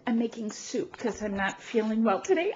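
ordinary delivery

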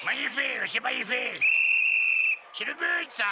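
high delighted praise; delighted cry. Acted. Coach cheering the goal, delighted and high. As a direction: gush over the goal, voice up high.

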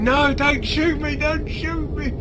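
high, slow mock begging; imitating his pleading. Retelling his plea to the teammate, high mock begging. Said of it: "I was going".

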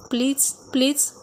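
slow, hushed romantic plea. A narrator's reading. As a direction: coax her gently, drawing it out. Husband pleading with wife in bath.

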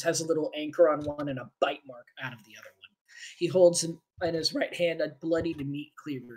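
quiet, low, dark description; low scene narration. A narrator's reading. Game master setting the butcher scene, quiet and low.

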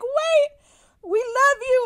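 high, loud, urgent declaration; calling out. Retold or imitated. Idol stopping fans as they leave stage, excited call. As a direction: call after them with the pitch way up.

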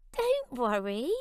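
high squeaky reassuring mouse voice; a tiny friend calming a giant; peeping mouse. Acted. Mouse reassuring the scared lion, peeping. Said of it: "Mouse peeped".

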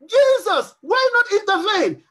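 calling upon Jesus. Acted. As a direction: plead upward, pressing for an answer. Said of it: "You are calling upon him".

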